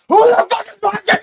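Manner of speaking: shouted high and loud in disbelief; loud outraged shout; excited outburst. Real, not acted. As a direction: blurt it out at the top of your voice. Excited reaction to a goal.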